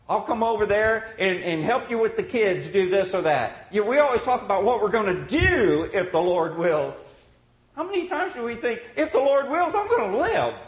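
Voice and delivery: loud, high preaching voice, building; rising sermon fervor; fervent preaching. Acted. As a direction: preach it out with fire. Preaching at full force.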